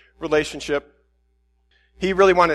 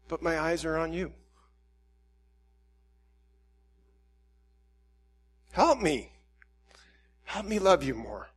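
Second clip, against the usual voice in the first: soft plea; 5 dB quieter than usual. Acted. Prayer softens to a plea.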